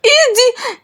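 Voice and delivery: very high, drawn cry of pain. Acted. Burned servant cries out about her hand, pained cry.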